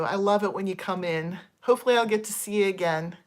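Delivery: normal talking voice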